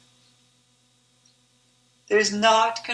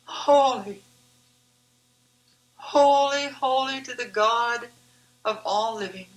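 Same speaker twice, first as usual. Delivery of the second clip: raised, reverent cry of holy, holy; crying out in awe; 2 semitones higher than usual. A narrator's reading. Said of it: "All I wanted to do was cry".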